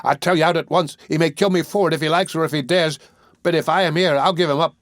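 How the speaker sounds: put-on excited boy shouting. A narrator's reading. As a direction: rouse the room, yell for help. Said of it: "becoming more and more excited as he spoke".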